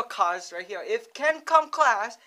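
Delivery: usual speaking voice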